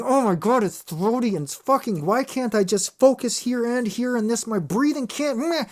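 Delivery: imitating a frustrated singer. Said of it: "I'm going".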